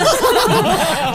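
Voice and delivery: big high burst of laughter; loud laugh. Real, not acted. (tawa lepas)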